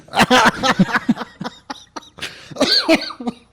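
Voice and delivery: loud, high burst of laughter. Real, not acted. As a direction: crack up. Laughs at the chocolate gel.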